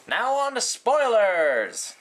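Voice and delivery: stretched-out grand announcement; announcer flourish. Real, not acted. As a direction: announce it like a showman. Announcing spoiler section.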